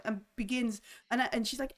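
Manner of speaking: ordinary delivery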